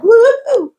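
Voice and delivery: high, gliding whoop; imitated woo-hoo. Walking in like a big bowling ball, imitated whoop. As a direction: whoop like something hurtling down the lane.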